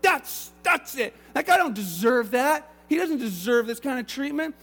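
loud, high, indignant outburst; older brother protesting to his dad, angry. Acted. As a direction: spit out how unfair it is. Older brother objects angrily.

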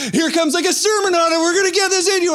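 high, eager coaxing; mock spoon-feeding voice. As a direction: push it on them like baby food. Feeding game applied to sermon.

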